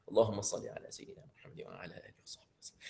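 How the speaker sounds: rapid whispered salawat; whispered blessing. Shown as a demonstration. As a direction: breathe the whole salawat out fast. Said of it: "same salawat said aloud before".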